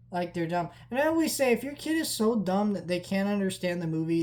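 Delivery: ordinary talk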